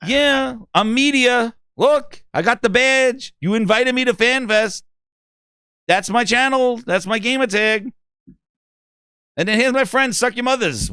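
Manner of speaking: high, insistent mock-earnest gamer; self-important media fan. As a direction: plead your credentials high and proud.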